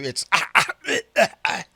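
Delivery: ordinary delivery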